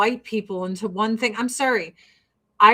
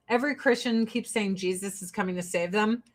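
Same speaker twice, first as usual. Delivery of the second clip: usual speaking voice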